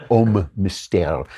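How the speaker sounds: slow, drawn-out mock French; deliberately poor French accent; bad French accent. Shown as a demonstration. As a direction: stretch the name out in bad French. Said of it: "that's my bad French accent".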